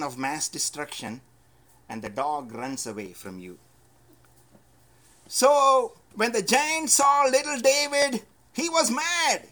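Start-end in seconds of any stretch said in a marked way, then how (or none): 5.3-9.5 preaching at full force. Acted. Preacher builds to Goliath's rage.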